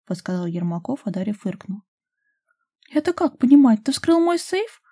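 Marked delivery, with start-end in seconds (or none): 2.9-4.8 high, loud, accusing question; frowning. A narrator's reading. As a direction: demand an explanation with a frown. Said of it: "нахмурилась Дашка". (возмущённый вопрос)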